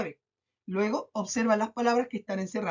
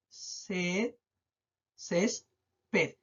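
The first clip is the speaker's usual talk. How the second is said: "césped" drawn out as céees, ped; syllable by syllable; 3 semitones lower, 5 dB quieter than usual. Shown as a demonstration. Teacher hunting for the stressed syllable, "césped" in slow beats. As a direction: stretch and separate the syllables of "césped", softly. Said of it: "Dónde se carga la voz".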